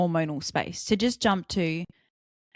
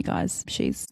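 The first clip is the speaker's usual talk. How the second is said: ordinary talk, nothing marked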